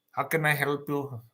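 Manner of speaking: quiet half-hearted front-desk line; receptionist. Retold or imitated. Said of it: "they work at doctor's offices".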